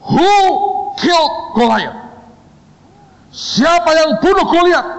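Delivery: high-pitched, booming mock demand; theatrical high shout at a suspect; acted interrogating shout. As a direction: fire the question like a stern examiner. Boss acts out stern interrogation.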